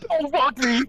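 ordinary talk, nothing marked

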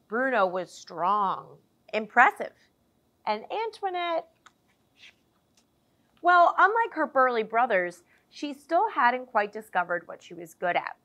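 lively picture-book storytelling voice; animated children's story narration; reading to children. A narrator's reading. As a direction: read it like story time for little ones. Reads picture book aloud.

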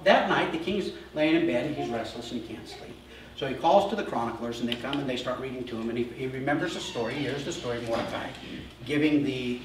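normal talking voice

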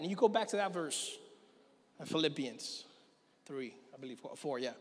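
ordinary talk

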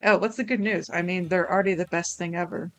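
usual speaking voice

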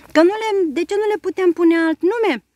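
put-on eager boyish suggestion. A narrator's reading. Tim eagerly proposes new names to the commander. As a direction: jump in eagerly with the idea.

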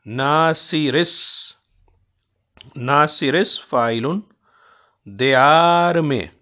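slow "नासिरिस" with the alif swallowed; scanning joined. Shown as a demonstration. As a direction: stretch "नासिरिस ... दियार में" slowly to the meter. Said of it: "अलिफ को हमने रा के अंदर गुम कर दिया". ("नासिरिस" को धीमे वज़न पर मिलाकर बोलना)

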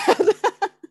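a bright, high, loud little laugh; embarrassed laugh. Real, not acted. Host laughing after apologizing for her son's interruption. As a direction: laugh away the awkwardness.

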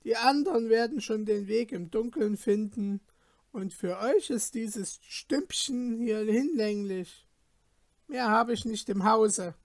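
landlady coolly refusing them candles; lightly voiced landlady. Innkeeper's wife voiced in story.

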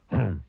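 short wordless "hmm" grunt; grumpy grunt. A narrator's reading. Mister Grumpy finds nobody behind him and grunts. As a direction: grunt it with displeasure. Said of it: "grunted Mister Grumpy".

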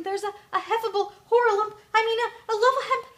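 high, loud, flustered stammering; frightened little creature raising the alarm; trembling panic. A narrator's reading. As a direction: trip over the words in fright.

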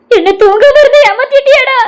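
high, slow crying reproach; a mother weeping over her son; mother's sobbing. Acted. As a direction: sob out the question.

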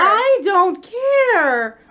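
slow, drawn-out tearful whine. Real, not acted. To her partner over excuses, slow tearful whine. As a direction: stretch it out, on the edge of tears. Frustrated, near tears.

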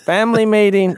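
high, booming call to the whole household; very loud voice. Shown as a demonstration. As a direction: holler it out to gather the family. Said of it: "say in a very loud voice".

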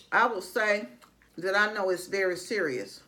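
chewing while talking; talking through food; mouth full. Real, not acted. Seen 1: Bite taken at -2.0; lips stay near-closed while speaking after.

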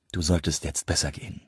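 soft, low, friendly dismissal. A narrator's reading. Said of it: "sagte er in freundlichem Ton". (freundlicher, leiser Ton)

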